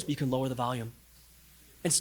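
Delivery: ordinary delivery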